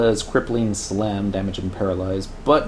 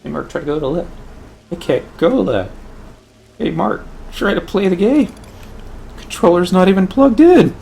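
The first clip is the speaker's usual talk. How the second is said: higher, louder skit dialogue; mock skit voices; 9 semitones higher, 6 dB louder than usual. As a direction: act out a comedy bit. Acting a quoted skit.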